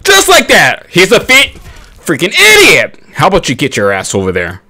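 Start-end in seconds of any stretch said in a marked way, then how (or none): 0.0-3.0 high, loud hype; hype callout. Acted. Gamer hyping his clutch defuse, high and loud. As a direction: crow over the clutch play.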